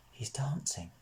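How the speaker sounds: soft, hushed wonder; whisper. Acted. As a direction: breathe it out in awe. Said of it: "whispers Natasha".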